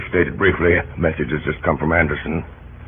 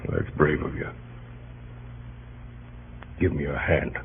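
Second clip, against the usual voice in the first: quiet warmth; 5 semitones lower, 11 dB quieter than usual. Acted. President forgives a humbled secretary.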